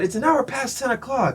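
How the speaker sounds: mock yell at moderate volume; imitated heckler's complaint. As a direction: mimic an annoyed man calling out. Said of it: "he's just shouting".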